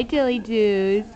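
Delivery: ordinary talk, nothing marked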